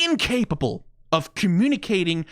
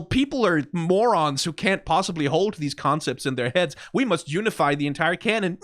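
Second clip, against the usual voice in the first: usual speaking voice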